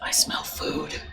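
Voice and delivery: whispered aside, barely voiced. Real, not acted. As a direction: hush it like a secret. Sudden aside.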